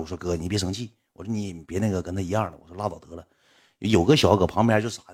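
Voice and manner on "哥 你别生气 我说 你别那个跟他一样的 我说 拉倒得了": low, soft appeasing voice; hushed plea to let it drop; placating. Retold or imitated. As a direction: talk someone down quietly. Retells calming an angry man.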